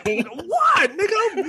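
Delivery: high-pitched, incredulous cry; exasperated disbelief. Acted. Co-host reacting to the home games line, high exasperated disbelief. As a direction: demand an explanation, voice shooting up.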